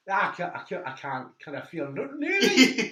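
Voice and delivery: high, loud Geordie impression that slips towards Irish; hammed-up Geordie, loud and high; mock Geordie accent. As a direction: exclaim it in a badly faked Geordie accent. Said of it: "is he from Ireland?".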